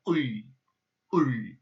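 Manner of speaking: "oi" with the tongue dropping back down the throat; "oi" pulled back and swallowed; throaty oi. Shown as a demonstration. Tutor drilling "oi" for Russian learners. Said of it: "tongue to go rapidly backwards down your throat".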